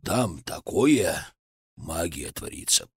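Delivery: gasping, slow and broken; out of breath from running. A narrator's reading. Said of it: "задыхаясь".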